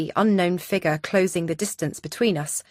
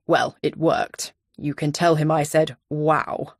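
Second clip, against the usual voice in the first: Donovan voice; 3 semitones lower, slower than usual. A narrator's reading.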